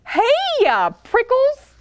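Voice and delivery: high, bright-eyed mock shout; jackrabbit shouting. A narrator's reading. Jackrabbit dashing past calls out to Hank, mock shout. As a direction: call out high at the cactus. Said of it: "she shouted".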